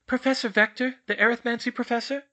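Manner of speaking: explaining schoolgirl. Acted. Schoolgirl clarifies for a confused portrait, high and questioning. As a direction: explain it loudly and carefully.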